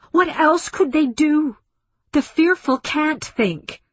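high, loud, impassioned plea; shouted protest. A narrator's reading. Woman defending the dead to the aliens, shouting. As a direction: cry it out in outrage. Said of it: "she shouted".

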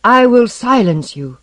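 high, loud shout. A narrator's reading. Young king shouting at the hostile mob from the balcony. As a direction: shout it down at a crowd. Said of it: "and shouted at them".

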